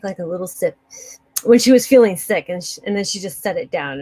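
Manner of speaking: ordinary delivery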